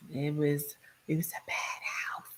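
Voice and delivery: hushed unvoiced whisper. Real, not acted. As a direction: drop to a whisper. Whispered aside about the abuse.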